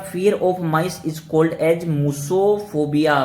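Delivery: each word laid out slowly; slow dictation. Shown as a demonstration. Teacher to students, dictating the definition slowly. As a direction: read the definition out slowly for notes. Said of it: "तो आपने क्या देखा?".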